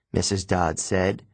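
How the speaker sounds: plain reading voice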